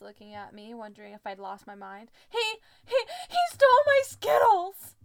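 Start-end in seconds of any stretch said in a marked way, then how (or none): 2.3-4.9 very high, loud accusation; outraged yell. A narrator's reading. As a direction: shriek it like a robbed child. Distraught accusation acted in story.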